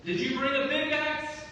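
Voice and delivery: loud, high, fervent sermon delivery; full-force preaching. Acted. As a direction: preach it out to the whole room.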